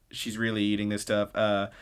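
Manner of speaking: ordinary talk, nothing marked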